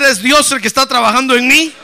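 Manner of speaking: loud preacher's call to repeat; loud declaration. Shown as a demonstration. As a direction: declare it firmly at high volume. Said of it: "A ver, más recio". (declaración en voz alta para que repitan)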